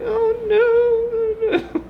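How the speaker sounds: very high, squeaky "oh no"; imitating wife. Vlogger imitates his wife fretting, in a very high voice. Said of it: "she'll be like".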